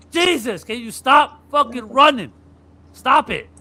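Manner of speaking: high, loud mock plea; exhausted player pleading. Tired defender begging Brunson to stop, high loud plea. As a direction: beg him to stop running. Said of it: "you're like".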